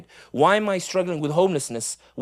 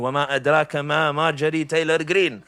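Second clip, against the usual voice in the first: rapid Quranic phrasing; much faster than usual. Acted. Rhetorical Quranic phrasing aside.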